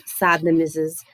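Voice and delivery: ordinary delivery, nothing marked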